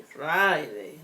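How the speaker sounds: ordinary talk, nothing marked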